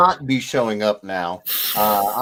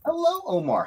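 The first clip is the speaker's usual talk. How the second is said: high greeting. Real, not acted. Greeting viewer.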